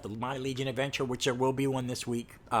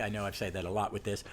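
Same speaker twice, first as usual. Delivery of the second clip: ordinary talk, nothing marked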